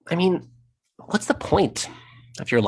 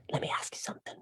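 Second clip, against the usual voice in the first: whisper; 15 dB quieter, much faster than usual. Acted. Menacing aside to audience.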